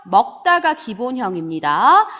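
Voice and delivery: ordinary talk, nothing marked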